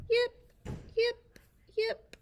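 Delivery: high, loud little yips; puppy yips. Acted. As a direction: yip like a tiny poodle. Puppies taught to yip.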